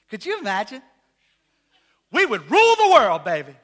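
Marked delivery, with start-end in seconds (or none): 2.1-3.6 mock boast. Real, not acted. Preacher joking to congregation, loud mock boast. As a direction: crow it like a playful king.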